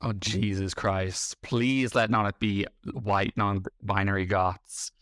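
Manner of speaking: normal talking voice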